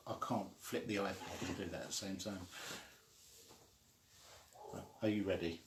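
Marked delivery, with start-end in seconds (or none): none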